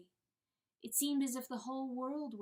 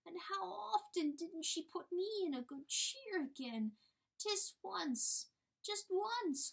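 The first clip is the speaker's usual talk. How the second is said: higher, slow, pleading hen voice; timid little hen; 8 semitones higher than usual. Acted. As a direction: play the humble little hen begging.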